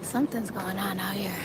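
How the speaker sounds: hushed whisper to viewers. Real, not acted. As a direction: whisper so nobody nearby hears. Sneaking toward the commotion.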